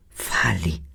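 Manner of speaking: soft whispered command. Acted. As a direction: breathe the word out, no voice. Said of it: "sussurrou a feiticeira".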